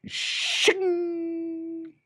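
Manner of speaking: high metallic shing sound; knife shing. Acted. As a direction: ring out a blade shing. Knives drawn sound.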